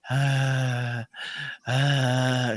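low, slow exhausted breaths; imitated laboured breathing. Podcaster imitating the starving runaway boy's breathing, laboured. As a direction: breathe out like a child about to collapse. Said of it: "a respiração ele tá assim".

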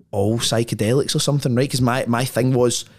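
ordinary talk

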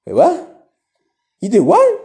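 high squeaky stunned "wait, what?"; a sudden high yelp of disbelief; shocked disbelief. Retold or imitated. Stunned by the homie's confession, squeaking high in disbelief.

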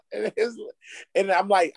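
ordinary delivery

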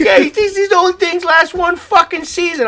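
high-pitched mocking imitation of a creep. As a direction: play the griping oddball up high. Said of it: "he sounds like a creep".

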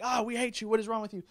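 mildly hammed-up outrage; mock rejection. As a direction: lightly play an exasperated rebuke. Imagined disciples rejecting Peter.

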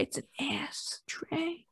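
hushed whispered correction. Acted. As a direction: whisper it secretly.

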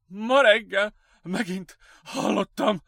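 high, loud, panicked gasps; panting. A narrator's reading. Patient telling his doctor what he heard, panting. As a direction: pant the words out in bursts. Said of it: "zihálta Lucas".

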